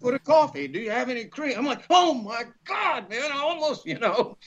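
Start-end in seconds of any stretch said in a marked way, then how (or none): 0.0-1.5 surprised what. Retold or imitated. Retelling a surprised exchange. 1.9-3.8 shocked gasp. Retold or imitated. Retold shock at a mix-up.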